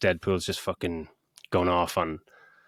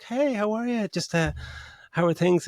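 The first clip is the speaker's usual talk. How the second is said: high, bouncy chummy greeting; a hammed-up cheery hello, pitched way up; imitating Jim Carrey; 11 semitones higher than usual. Retelling Jim Carrey greeting Tommy Lee Jones, high and chummy. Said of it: "he's just like".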